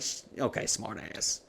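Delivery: put-on voiceless, softened aside to a kid; hushed retort. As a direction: shush them under your breath. Dad hushing daughter.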